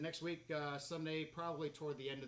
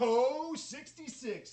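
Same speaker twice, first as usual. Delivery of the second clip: bingo caller; 9 semitones higher, 12 dB louder, much slower than usual. Acted. Bingo caller shouting the number.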